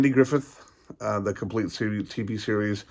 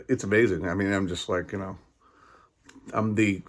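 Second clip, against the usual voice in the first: ordinary talk, nothing marked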